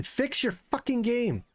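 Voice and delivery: high, slow, drawn-out frustrated demand; exasperated plea. Real, not acted. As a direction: beg the developers in exasperation. Streamer angry at game bugs.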